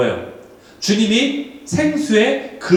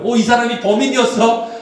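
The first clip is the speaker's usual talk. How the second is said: acted gasp of discovery, higher pitch; mock astonished question, pitched up; surprised viewer; 5 semitones higher than usual. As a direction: react to a shocking plot twist. Imitating viewer shocked by twist.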